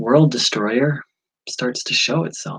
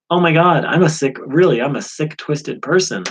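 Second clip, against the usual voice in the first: louder, higher hammed-up dismay; mock self-horror; 6 semitones higher, 6 dB louder than usual. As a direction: act shocked at yourself. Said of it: "I would have been like".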